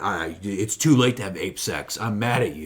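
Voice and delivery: low mock grumble of refusal; mock sulky refusal. Retelling the hero turning down the ape woman, mock sulky. Voicing film character refusing.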